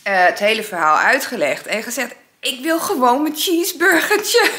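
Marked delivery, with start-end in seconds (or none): 2.4-4.5 laughing. Retold or imitated. Laughing version before repeat.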